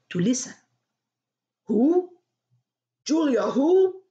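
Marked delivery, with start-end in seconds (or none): 1.7-4.0 loud, higher, baffled boss on the phone; startled demand. Acted. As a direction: bark the question in disbelief. Angry Mac surprised on phone.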